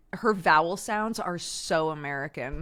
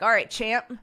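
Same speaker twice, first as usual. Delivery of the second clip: mock baseball dad; 6 semitones higher, 7 dB louder than usual. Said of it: "walk around and be like".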